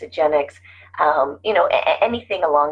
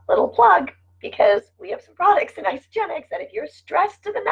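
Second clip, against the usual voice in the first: higher, bright pitch for a little plug, laughing at the end; upbeat sales plug; 13 semitones higher than usual. Real, not acted. Vlogger plugging Isagenix products to viewers, upbeat and higher. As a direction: lift your voice into a cheerful sales pitch.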